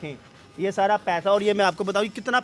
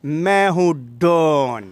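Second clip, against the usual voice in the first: slow, lower, punchy Don dialogue; dramatic punchy hero lines; 3 semitones lower, 3 dB louder, much slower than usual. Acted. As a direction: hit it like a film villain. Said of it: "ये जो फिल्मी dialogue है".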